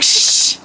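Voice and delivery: hard, impatient "shh"; abrupt loud shush. Retold or imitated. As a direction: shush them forcefully, no kindness. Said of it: "No, like".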